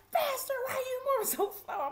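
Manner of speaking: high, loud scolding; harry a slowpoke at a shriek; imitated screaming sheriff. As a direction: mimic a guard hollering impatiently. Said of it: "screaming and hollering at us".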